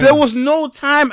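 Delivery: slow, high and loud; dramatic. Acted. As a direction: draw it out like a big reveal. Echoing friend dramatically.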